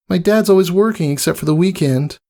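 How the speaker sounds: high, shy little filly; small admiring voice; lightly voiced filly. Scootaloo answering the mare, shy filly voice.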